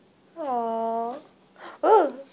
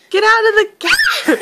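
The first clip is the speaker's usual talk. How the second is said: louder, higher, through laughter; laughing shout; 6 semitones higher, 4 dB louder than usual. Pretend. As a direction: yell it while laughing. Parent louder laughing repeat.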